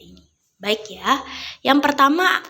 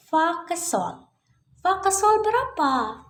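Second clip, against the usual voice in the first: high-pitched prompting question; singsong quizzing; 8 semitones higher than usual. Pretend. Teacher poses the third question to children, singsong quizzing. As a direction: lilt it high, inviting the class to answer.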